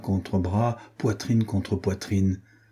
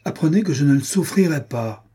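higher-pitched, emphatic warning; indignant anger; 5 semitones higher than usual. A narrator's reading. Jealous youth warning off his rival, indignant. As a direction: lay down the law, restrained anger. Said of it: "Armand Louis écumait de colère".

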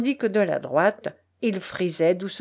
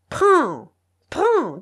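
high, loud repeated order; forceful command; 7 semitones higher, 6 dB louder than usual. A narrator's reading. Tchelkash shoves banknotes in his face, commanding. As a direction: thrust each word at him. Forcing money on him.

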